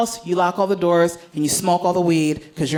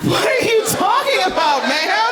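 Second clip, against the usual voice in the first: startled disbelief; 9 semitones higher than usual. Retold or imitated. Panicked reaction to microwave.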